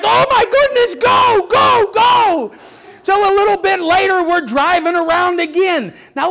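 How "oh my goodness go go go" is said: high, urgent mock panic; panicked kids. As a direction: imitate frightened children urging the driver away. Kids fleeing a crocodile.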